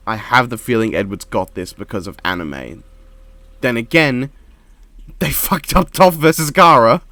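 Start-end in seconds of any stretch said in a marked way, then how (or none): 5.2-7.0 loud, laughing disbelief at fucked; incredulous laugh through the words. Real, not acted. Exasperated rant about bad matchup.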